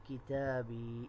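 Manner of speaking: کتابی said slowly with a long final ی; slow model word. Shown as a demonstration. Teacher models کتابی slowly for students to repeat. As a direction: draw out کتابی for the class to copy. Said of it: "اور یوں بولے".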